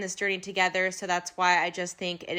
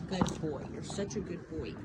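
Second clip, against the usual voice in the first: tender praise; 8 dB quieter than usual. Real, not acted. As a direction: murmur it lovingly. Praising the horse softly.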